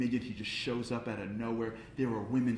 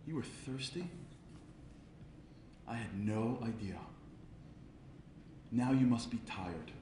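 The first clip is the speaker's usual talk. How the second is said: soft, unhurried realization; tender concern; 7 dB quieter than usual. Retold or imitated. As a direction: speak gently to a tired little creature. Moshe tenderly addresses the sheep.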